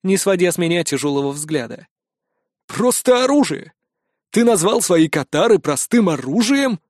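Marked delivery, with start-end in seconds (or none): 2.7-6.8 furious instructor voice; outraged, scolding hard; angry. A narrator's reading. Instructor berates hero.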